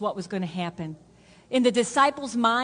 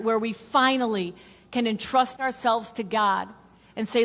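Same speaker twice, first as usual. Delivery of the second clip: ordinary delivery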